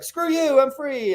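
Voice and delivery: high cheeky defiance; defiant dog. Retold or imitated. Dog once the collar comes off, high cheeky defiance. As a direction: toss it off like a freed rebel. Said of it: "they're like".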